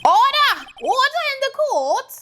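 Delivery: high, insistent judge's call; high-voiced courtroom command; calling for order.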